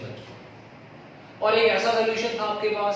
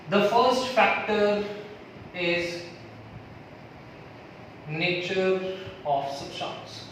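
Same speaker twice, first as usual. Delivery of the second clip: slow dictation; much slower than usual. Acted. Dictating a factor to students.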